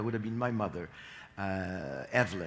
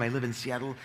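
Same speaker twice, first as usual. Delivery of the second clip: usual speaking voice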